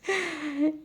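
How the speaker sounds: high laugh at her own prank; amused laugh. Real, not acted. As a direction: burst out in a high laugh mid-story. (اپنی شرارت پر اونچی ہنسی)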